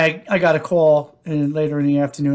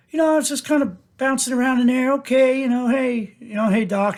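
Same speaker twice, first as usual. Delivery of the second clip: breezy nonchalance; 8 semitones higher than usual. Retold or imitated. Casual bouncing around before news.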